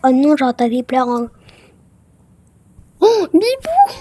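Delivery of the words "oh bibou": crying out. Pretend. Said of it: "Pourquoi ta voix elle est comme ça là?".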